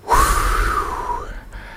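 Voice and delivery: long unvoiced sigh before a dedication; emotional sigh; heavy sigh. Real, not acted. Pauses during an on-air dedication.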